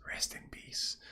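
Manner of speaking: hushed, voiceless whisper. Acted. Character leaning over where the spirit was, whispering. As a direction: whisper it softly over the departed. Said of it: "leans forward to where the dude was and whispers".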